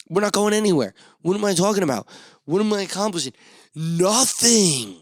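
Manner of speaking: hammed-up frustrated outburst; mock rant. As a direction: rant at nobody in particular.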